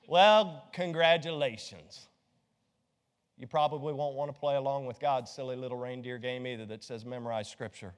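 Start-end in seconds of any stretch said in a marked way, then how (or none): none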